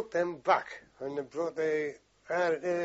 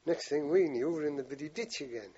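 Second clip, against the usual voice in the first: usual speaking voice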